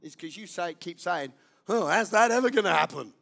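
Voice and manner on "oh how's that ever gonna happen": 1 doubting scoff. Retold or imitated. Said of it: "why some things don't come to pass".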